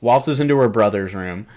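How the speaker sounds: usual speaking voice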